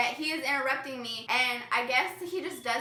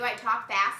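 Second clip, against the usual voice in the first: normal talking voice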